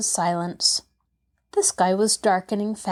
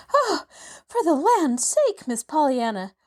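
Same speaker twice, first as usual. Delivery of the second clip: much higher, breathless relief; panting; 7 semitones higher than usual. Acted. Maid reaching the girl after running, panting. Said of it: "panted Nancy".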